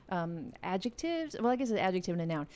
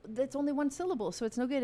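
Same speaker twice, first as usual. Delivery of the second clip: normal talking voice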